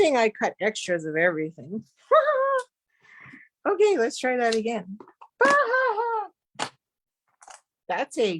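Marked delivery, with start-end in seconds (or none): 2.1-2.6 high-pitched laugh; short, squeaky laugh. Real, not acted. Laughs at her own mistake. 5.4-6.3 high, crying laugh; half-wailed high laugh at your mishap. Real, not acted. Laughs at her own mistake.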